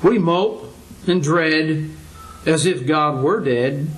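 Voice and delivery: drawn out, each word weighted, x0.5; slow preacher's couplet; slow rhyming line. Acted. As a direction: let the rhyme land slowly.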